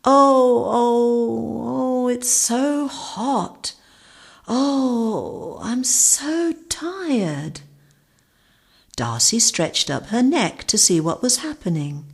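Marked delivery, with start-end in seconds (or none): 0.0-7.6 moaning. A narrator's reading. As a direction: moan wearily in the heat. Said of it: "moaning coming from the grass".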